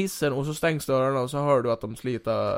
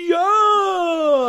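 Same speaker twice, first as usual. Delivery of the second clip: very high, loud yell; long, piercing mock cheer; crowd cheer; 9 dB louder than usual. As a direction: scream it as a whole crowd. Crowd answers the new ruler.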